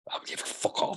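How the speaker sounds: irritated whispered aside; fed-up inner voice whispering back at the nagging; annoyed whisper. Acted.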